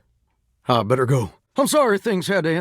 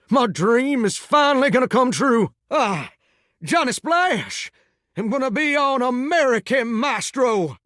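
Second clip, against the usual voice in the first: higher-pitched, thrilled proclamation; excited announcement; 6 semitones higher than usual. Acted. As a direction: proudly announce your big dream coming true. Announces dream finally coming true.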